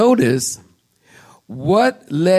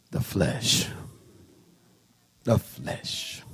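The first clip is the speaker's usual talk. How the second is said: low, soft, weighty naming; hushed emphasis; 7 semitones lower, 10 dB quieter, much slower than usual. Real, not acted. Pastor naming next sermon's topic, hushed. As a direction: drop low and soft on each phrase.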